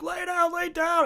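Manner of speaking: high-pitched mock urging; urgent neighbors. Neighbors to the injured rider, high urgent calls. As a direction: imitate onlookers calling in a high voice.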